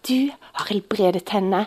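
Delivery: hushed, breathy wonder to God; softly amazed prayer after the healing; awed whisper. Retold or imitated. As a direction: whisper it, moved to the core. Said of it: "jeg ble så beveget".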